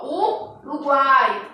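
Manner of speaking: "Uruguai" drawn out slowly syllable by syllable, U-ru-guai; teacher slowly separating syllables; slow syllable demo. Shown as a demonstration. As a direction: stretch out "Uruguai" to show the triphthong. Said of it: "olha só".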